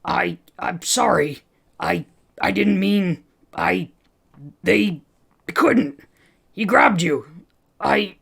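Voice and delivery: babbling almost hysterically to his friend. Acted. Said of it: "he was babbling almost hysterically".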